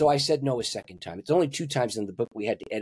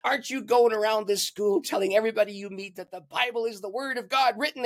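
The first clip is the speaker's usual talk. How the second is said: a mock stern telling-off, pitched higher; retold scolding; 10 semitones higher than usual. Sweet girl scolding David with fire in her eyes, mock stern. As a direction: play the fiery scolding friend, half in jest. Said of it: "with the fire coming out of her eyes".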